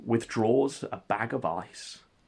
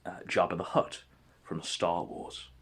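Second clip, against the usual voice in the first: ordinary delivery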